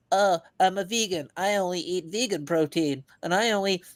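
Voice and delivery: lightly mock a picky vegan. Mocking complaining Americans with a whiny vegan voice.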